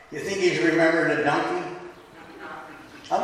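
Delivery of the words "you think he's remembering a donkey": ordinary talk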